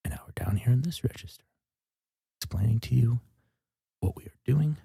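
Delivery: breathy ASMR whisper into the mic; hushed ASMR voice. Pretend. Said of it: "our world famous A S M R minute".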